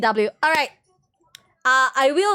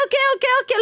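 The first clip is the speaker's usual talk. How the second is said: higher, rapid okays; excited repeated okay; a burst of glee; 11 semitones higher, much faster than usual. Real, not acted. Excited at game result.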